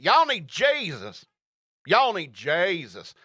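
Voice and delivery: drawn-out, high-swooping Southern accent. Shown as a demonstration. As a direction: give it a big slow Southern twang. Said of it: "I'll do it in a Southern accent".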